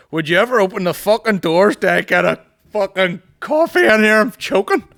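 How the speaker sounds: loud, high-pitched hammed-up demand; desperate shouting. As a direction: yell like a frantic coffee addict. Acting out desperate coffee addict's message.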